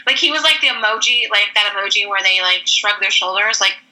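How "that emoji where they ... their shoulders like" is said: normal talking voice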